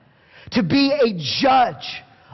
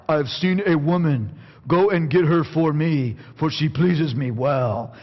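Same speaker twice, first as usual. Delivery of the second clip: low plodding demand for the woman; dim Samson quote; 8 semitones lower than usual. Retold or imitated. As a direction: quote Samson as a bit of a brute. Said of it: "you almost have to say it with a caveman accent".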